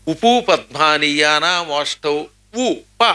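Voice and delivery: slow, chanted grammar rule; sutra recitation. Shown as a demonstration. Teacher reciting a Sanskrit grammar rule, chanted slowly. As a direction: intone the Panini sutra slowly. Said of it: "పెదవులు రెండు కదులుతాయి".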